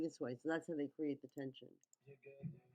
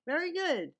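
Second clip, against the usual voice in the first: excited praise; 10 semitones higher, 7 dB louder than usual. Real, not acted. Praising the shot he likes.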